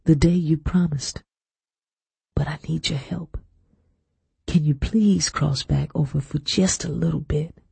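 hushed, voiced plea; whisper. A narrator's reading. Daughter pleading with dead Mama at the graveside, hushed. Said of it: "I whispered".

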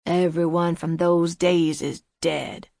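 put-on hoarse, evasive old man. A narrator's reading. Old man deflecting questions about the dead, hoarse. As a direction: let the old voice rasp hoarsely. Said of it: "he said hoarsely".

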